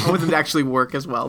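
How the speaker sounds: ordinary talk, nothing marked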